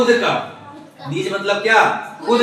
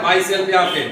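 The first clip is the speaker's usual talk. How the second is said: ordinary delivery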